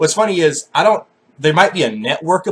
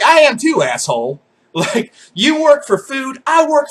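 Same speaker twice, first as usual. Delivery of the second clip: mock retort; 9 semitones higher than usual. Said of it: "I was like".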